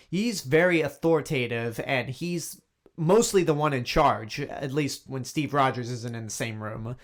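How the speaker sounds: usual speaking voice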